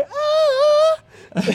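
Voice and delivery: very high falsetto "aah"; comic forced falsetto vocalise; mock vocal exercises. Shown as a demonstration. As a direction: hold a squealed falsetto note. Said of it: "fai i vocalizzi".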